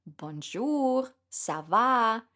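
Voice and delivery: self-consciously mangled French greeting; amateur French accent; bad French accent. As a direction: try a clumsy French hello. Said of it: "Sorry for my terrible accent".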